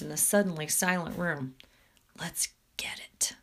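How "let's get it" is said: low conspiratorial whisper. A narrator's reading. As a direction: whisper it to your accomplices. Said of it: "whispered Hollis".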